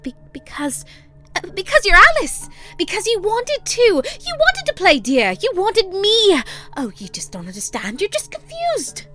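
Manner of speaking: baffled Hatter pushing back hard; high, loud, near-angry Hatter voice; baffled, almost angry. A narrator's reading. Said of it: "almost angry".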